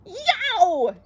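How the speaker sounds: high, startled yelp; yell. A narrator's reading. Bert thinks something is crawling on him, high startled yell. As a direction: let out a sudden high yell. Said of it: "yelled Bert".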